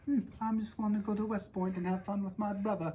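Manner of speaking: put-on high, sweet innocent boy voice; innocent little kid. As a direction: play it like a harmless kid. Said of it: "like an innocent little kid".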